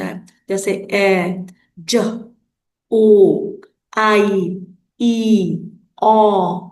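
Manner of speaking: each sound "ओ", "आई", "ई" punched out alone with pauses; teacher drilling sounds "ओ, आई, ई, उ, ऊ"; sounding out vowel teams. Shown as a demonstration. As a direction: model each vowel team sound crisply. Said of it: "कैसे उच्चारण होती है".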